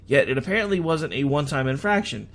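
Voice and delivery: ordinary talk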